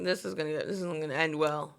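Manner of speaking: low, soft, foreboding aside; ominous mutter. Retold or imitated. Waxer thinking to himself, low ominous mutter. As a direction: mutter it like doom is coming. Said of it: "He's like".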